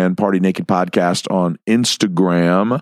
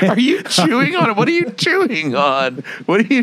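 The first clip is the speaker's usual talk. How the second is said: put-on much higher, puzzled girl voice; 12 semitones higher than usual. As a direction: squeak it like a suspicious girlfriend. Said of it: "she's like".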